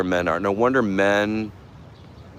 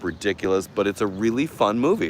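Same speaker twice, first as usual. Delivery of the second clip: usual speaking voice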